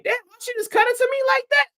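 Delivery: very high, fast, squeaky disbelief; replaying the shock of being dissed, very high; hurt disbelief. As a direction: pitch way up in wounded confusion.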